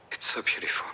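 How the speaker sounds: whispered, wistful wonder; soft whisper; hushed awe. Acted. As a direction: say it under your breath, moved by the view. Wistful confession about the view.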